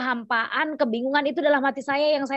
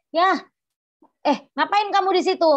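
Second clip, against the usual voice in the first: retold angry husband; 7 semitones higher, 4 dB louder than usual. Husband confronting her at church.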